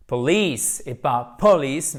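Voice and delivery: POLICE then police, first stressed wrong then stressed on the second syllable; stress shift. Shown as a demonstration. Teacher contrasting police and police for French learners. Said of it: "On a accent tonique".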